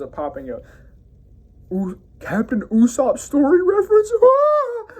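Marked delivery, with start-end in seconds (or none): 1.7-4.1 high, loud, slowed squeak of a panicked crewmate; squeaky cartoon voice. Reviewer voicing a startled crewmate at the goldfish, high squeaky mock alarm. 4.2-4.9 very high, loud cartoon shriek; shrill mock scream. Reviewer screaming as a crewmate at the giant goldfish, high cartoon shriek.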